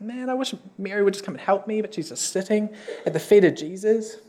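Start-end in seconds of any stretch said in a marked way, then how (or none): 0.0-1.8 quick, quiet grumble to oneself; annoyed Martha. Acted. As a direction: grumble softly and fast, a touch of annoyance. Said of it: "She's kind of annoyed".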